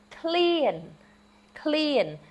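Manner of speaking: "Clean" said high and falling; stress on first syllable. Shown as a demonstration. Teacher models the accent of the word for hungry. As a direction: hit the start of "Clean". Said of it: "first syllable of it accented".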